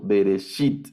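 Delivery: Bereshit with stress on the final -shit; acento en la última. Shown as a demonstration. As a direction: land firmly on the last syllable of Bereshit. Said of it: "aquí va el acento". (Bereshit, con el acento en la última sílaba)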